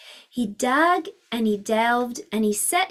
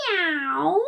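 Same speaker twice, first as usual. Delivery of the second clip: cat meow; 4 semitones higher than usual. Acted. Cat in story.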